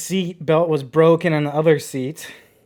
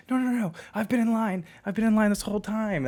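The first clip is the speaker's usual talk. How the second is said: high-pitched mock panic; imitating woman freaking out; 9 semitones higher than usual. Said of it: "this one woman starts freaking out".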